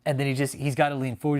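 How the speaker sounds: normal talking voice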